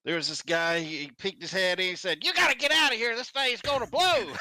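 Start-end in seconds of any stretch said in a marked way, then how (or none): 2.2-4.3 much higher and louder, alarmed warning; urgent warning. Retold or imitated. Man peeking into the wrecked car warns him, alarmed shout. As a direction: yell the warning like the car is about to blow.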